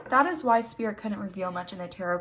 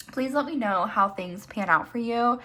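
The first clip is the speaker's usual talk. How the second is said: normal talking voice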